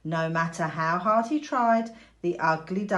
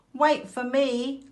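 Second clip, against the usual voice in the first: high, loud, slow call to the others; 7 semitones higher, 5 dB louder, slower than usual. A narrator's reading. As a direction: call after them, left behind. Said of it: "called the ugly duckling".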